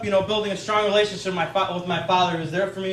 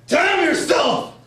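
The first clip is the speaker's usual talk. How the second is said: high, drawn-out mock shout; dad swearing; 7 semitones higher, much slower than usual. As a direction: imitate a furious father cursing clumsily. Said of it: "he would swear at me in incomplete sentences".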